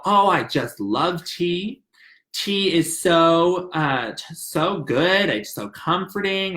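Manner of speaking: slow, savoring each word; lightly voiced slow counselor; slow and dreamy. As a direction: linger over the love of tea. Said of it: "he doesn't speak fast, ever".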